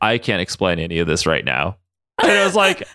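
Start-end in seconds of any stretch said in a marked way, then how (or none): 0.0-1.8 low-pitched tough-guy impression; Logan. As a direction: drop the pitch right down for a mock brooding reply. Voicing Logan's reply.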